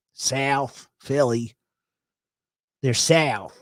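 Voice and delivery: slow, thick South Philly drawl; broad South Philly demo; South Philly accent. Shown as a demonstration. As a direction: stretch the Philly vowel in South. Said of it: "I can totally do the Philly thing".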